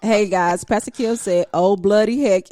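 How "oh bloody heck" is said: loud mock British exclamation; British accent. Co-host reading out the pastor's comment, British accent. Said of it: "in my British accent".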